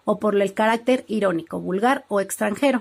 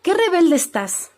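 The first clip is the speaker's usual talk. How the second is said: exclamation demo; 6 semitones higher, slower than usual. Shown as a demonstration. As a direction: give each exclamation a lift. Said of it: "¿Algún ejemplo?". (exclamación marcada, más aguda)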